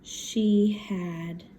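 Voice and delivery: word by word with long pauses; slow dictation. Real, not acted. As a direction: say it slowly as you write it down. Writing the sentence while saying it.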